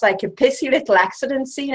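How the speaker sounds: ordinary delivery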